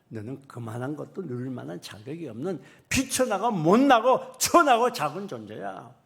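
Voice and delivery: loud stern scolding, slowing in the middle; God rebuking. Acted. God loudly scolds the listener who grumbles. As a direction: rebuke the grumbler with full force. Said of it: "하나님 뭐라고 말해".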